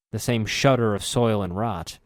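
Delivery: usual speaking voice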